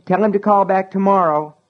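high, loud yell down the stairs. Acted. Brian yells down to his brother, high and loud. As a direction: shout it so it carries downstairs. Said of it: "he yelled".

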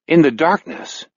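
plain reading voice

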